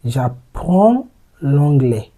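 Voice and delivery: very slow, careful French phrase; slow model phrase. Shown as a demonstration. Teacher slowly models j'apprends l'anglais for learners. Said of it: "would be".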